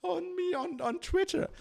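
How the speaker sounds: high, whiny mock of a journalist complaining; sneering high impression of a self-pitying journalist. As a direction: lift it way up and whine it like a wounded reporter. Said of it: "be like".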